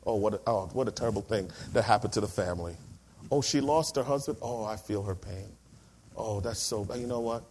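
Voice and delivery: low, soft, sighing sympathy; soft mocking churchy sympathy; mock pious pity. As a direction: imitate a churchgoer's hollow pity. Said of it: "they go".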